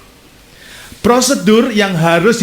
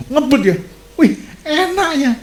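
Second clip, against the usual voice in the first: gleeful; 9 semitones higher than usual. Retold or imitated. Preacher recalling his friend who cheats without fear, gleeful. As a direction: marvel enviously in a high voice.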